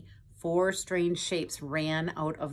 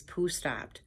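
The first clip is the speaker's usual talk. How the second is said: ordinary delivery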